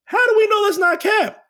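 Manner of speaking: high, bright-eyed "wait a minute"; hammed-up dawning suspicion; mock Maddie realisation. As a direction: play it like a sudden suspicious doubt. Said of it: "Nothing in Maddie's brain says".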